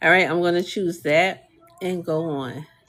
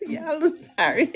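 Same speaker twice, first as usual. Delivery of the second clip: laughing apology; 9 semitones higher than usual. Real, not acted. Still cracking up.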